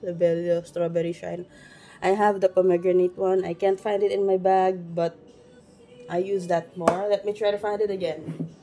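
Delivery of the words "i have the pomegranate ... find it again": ordinary delivery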